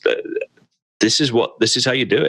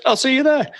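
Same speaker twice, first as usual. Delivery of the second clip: much higher, breezy imitation of the dwarf; imitating Magni. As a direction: toss it off lightly in a high voice, like a quest giver who already left. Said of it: "and then he just goes".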